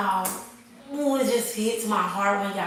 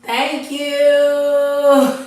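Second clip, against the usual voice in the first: high, much louder, triumphant "Thank you"; excited thanks; 7 semitones higher, 9 dB louder than usual. Real, not acted. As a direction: crow it out, higher and louder. Cheering her own point.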